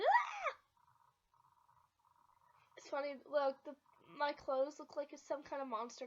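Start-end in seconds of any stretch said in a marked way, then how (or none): 0.0-0.5 mock realising yelp. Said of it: "We'll be like".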